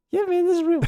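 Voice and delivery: squeaky falsetto, way up high. Shown as a demonstration. Asked by his cohost for a real voice, going very high. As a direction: jump into a high off-air voice. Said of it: "Real [name] voice".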